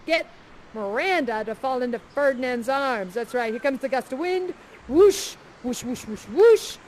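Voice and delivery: normal talking voice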